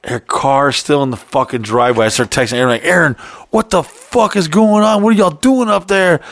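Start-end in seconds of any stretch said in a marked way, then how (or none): 2.8-6.2 high-pitched, exasperated demand; freaking out. Retold or imitated. Man texting his friend from outside, freaking out. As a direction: replay frantic texts at a raised pitch. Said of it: "I'm, like, freaking out".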